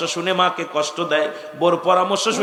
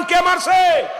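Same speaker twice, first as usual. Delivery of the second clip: full-throated shout, much higher and louder; loud call-and-response shout; 11 semitones higher, 3 dB louder, slower than usual. Real, not acted. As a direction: belt it out as the preacher demands. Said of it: "আরো জোরে বলেন".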